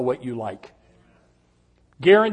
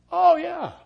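realizing; 13 semitones higher than usual. Retold or imitated. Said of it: "remember what I was doing".

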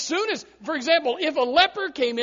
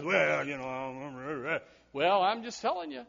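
much lower, slow, softer lightly voiced shrugging excuse; mock excuse; 8 semitones lower, 8 dB quieter, slower than usual. Preacher mimicking a hesitant church member, mumbled mock excuse. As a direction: play a sheepish member backing off.

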